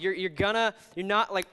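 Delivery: ordinary talk, nothing marked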